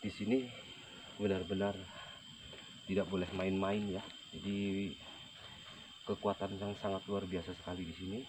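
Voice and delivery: hushed, nervous report; nervous night vlog whisper. Real, not acted. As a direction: keep it low and uneasy. Hushed report at haunted site.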